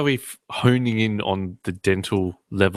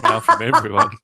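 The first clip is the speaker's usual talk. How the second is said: laughing; 4 dB louder than usual. Real, not acted. Joking after offending everyone.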